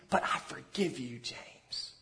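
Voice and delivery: put-on soft, quiet words of forgiveness to a little boy; gentle forgiveness. Shown as a demonstration. Parent softly forgiving a toddler after discipline, gentle. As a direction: tell the child softly that he's forgiven. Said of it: "Come back with the good news and say".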